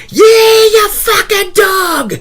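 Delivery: retold mirror shout. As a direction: bellow it slowly at your own reflection. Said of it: "went up to the mirror and was like".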